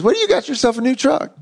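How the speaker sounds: high, quick, surprised voice of the father; surprised old dad. Retold or imitated. Father stepping out to see his son's truck, high and startled. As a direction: squeak out the question like a stunned dad. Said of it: "he comes out and he goes".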